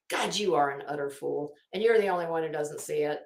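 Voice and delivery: ordinary talk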